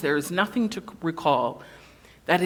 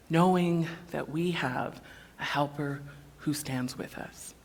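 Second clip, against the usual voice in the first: usual speaking voice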